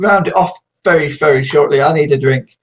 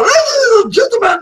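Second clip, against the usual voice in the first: much higher, giddy call; hammed-up high greeting; 10 semitones higher than usual. Streamer greeting viewers again more excitedly. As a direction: pitch it up with glee. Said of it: "repeated greeting, higher".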